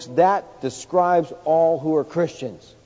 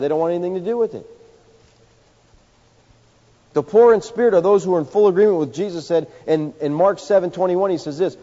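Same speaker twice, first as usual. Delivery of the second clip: ordinary talk, nothing marked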